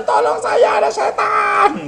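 put-on high, panicked yell of a scared boy; a child's shriek as devils reach the door; frightened cry for help. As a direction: cry out to the Lord in terror. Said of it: "dia teriak sama Tuhan".

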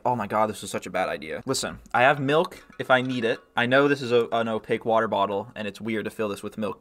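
ordinary talk, nothing marked

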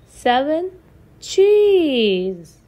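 ordinary delivery

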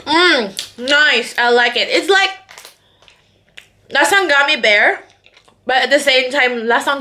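talking with food in mouth; slightly muffled chewy speech; chewing candy while talking. Real, not acted. Seen 1: Candy into mouth at -4; closed-lip chewing while talking through +2.5.

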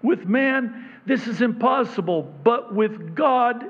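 full-force sermon voice; fervent preaching. Acted. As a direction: drive it home to the congregation. Preaching climax.

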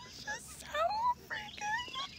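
high squeak. Real, not acted. Admiring the view.

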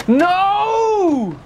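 high sharp real no; frustrated yell. Real, not acted. Skater yelling at the game as the grind fails. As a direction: cry out at the failed grind.

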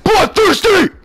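high, loud victory yell; triumphant shout. Real, not acted. Gamer celebrating a bloodthirsty streak, triumphant shout.

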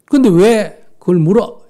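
loud, slow mock-irritated question; drawn-out belligerent comeback; irritated challenge. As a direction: demand the reason as the angry man. Angry man challenges the question.

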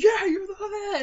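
high, loud outburst mimicking a housemate; sudden high-pitched imitated yell; mock shout. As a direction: shout it like you're piling on. Acted pile-on quote from housemate.

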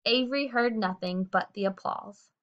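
ordinary delivery

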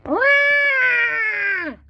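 high, loud imitated baby cry; long piercing mock-baby wail; baby's wail. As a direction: bawl like a storybook baby. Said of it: "cried Polly".

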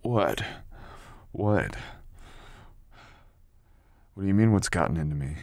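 loud, fast disbelief; indignant shout. Acted. Partner shouting back at girl in disbelief, loud and fast.

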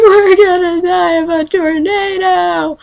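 mock doom wail. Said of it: "I'm like".

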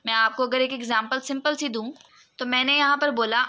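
usual speaking voice

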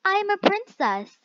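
pitched high, prissy princess; lightly voiced high princess; high mock princess. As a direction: squeak it out like a spoiled princess. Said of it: "And she says".

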